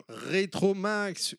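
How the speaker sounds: slow drawn-out title reveal; announcer voice. Acted.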